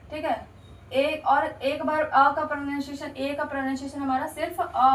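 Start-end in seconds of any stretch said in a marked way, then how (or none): none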